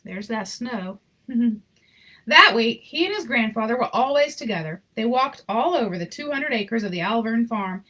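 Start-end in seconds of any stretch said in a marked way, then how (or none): 2.3-7.8 gentle read-aloud storyteller voice; story narration. A narrator's reading. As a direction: read it like a bedtime picture book. Reading picture book aloud.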